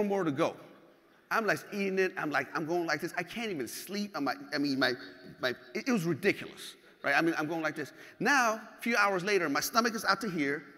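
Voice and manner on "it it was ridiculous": ordinary delivery, nothing marked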